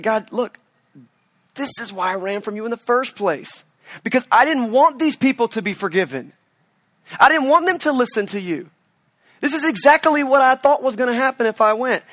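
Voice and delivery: rising from mildly upset to loud and high; an exasperated prophet's rant, building; building frustration. Acted. Jonah voiced complaining to God.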